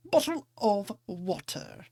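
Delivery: "Bottle of water" with dropped t's, slow; Cockney "Bottle of water", t's swallowed; London accent. Shown as a demonstration. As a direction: glottal stops in "Bottle of water", drawn out. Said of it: "I may not have a London accent".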